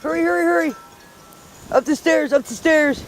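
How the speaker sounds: high, coaxing call to the dog. Real, not acted. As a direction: urge the dog up the stairs.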